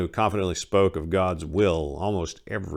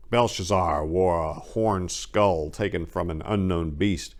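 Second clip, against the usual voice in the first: ordinary delivery, nothing marked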